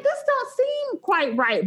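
skeptical. Retold or imitated. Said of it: "'cause I was like".

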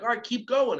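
bright, eager push to keep going; excited urging. Retold or imitated. Teacher urging a fourth grader to keep going, excited.

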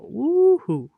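high excited cheer; woohoo. Real, not acted. Cheering the episode number.

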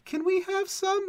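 put-on high, drawn-out child's plea; pleading grandkids. Grandkids begging grandpa for almonds, pleading high. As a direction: ask slowly in a high little kid's voice. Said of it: "my grandkids are always gonna be like".

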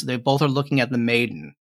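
normal talking voice

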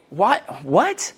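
exasperated questioning, pitch jumping up; blunt incredulity. Retold or imitated. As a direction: demand an explanation, baffled and impatient. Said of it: "I'll say it a certain way".

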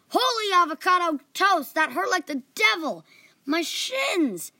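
kicked in the shins, yelling in pain. A narrator's reading. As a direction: yelp out after a kick to the shins. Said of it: "Jahir yelled in pain".

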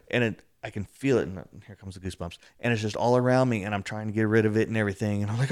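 ordinary talk, nothing marked

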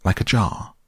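high-pitched, groping for the words; flustered Piglet. Acted. Piglet tells Christopher Robin what he saw, flustered. Frightened Piglet describes the creature.

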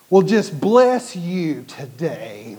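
slow, drawn-out sarcastic blessing; mocking blessing. Imitating the angry lady who blesses the rude man with sarcasm. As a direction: stretch the bless out with fake sweetness. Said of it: "like that".

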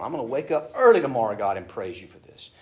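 usual speaking voice

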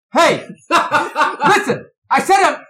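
very high, loud screamed outburst; descending into rage. Retold or imitated. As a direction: yell furiously down the phone as an exasperated dad. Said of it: "this is how it descends".

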